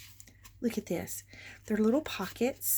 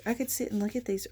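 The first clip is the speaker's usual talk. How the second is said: ordinary talk, nothing marked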